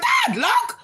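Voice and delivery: put-on very high excited kid calling; very high child's call to dad; childlike call. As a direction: squeal it like a little kid showing dad.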